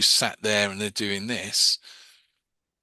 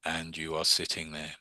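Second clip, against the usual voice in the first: put-on low, soft, droning old-time hypnotist; monotone robot voice; 5 semitones lower, 5 dB quieter than usual. Imitating a fifties hypnosis tape, monotone robot voice. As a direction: mimic a droning recorded hypnotist. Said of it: "speak in that monotone, like, robot voice".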